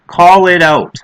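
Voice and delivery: loud, punched repeat; firm command. Real, not acted. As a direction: hammer the command home. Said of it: "repeated louder as command".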